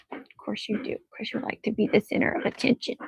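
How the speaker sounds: a soft, teasing whisper. Real, not acted. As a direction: whisper it with a grin to the pet. Teasing the dog softly.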